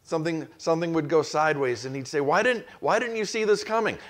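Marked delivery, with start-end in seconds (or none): 2.2-4.0 exasperated boss. Retold or imitated. Boss blaming employee, acted exchange.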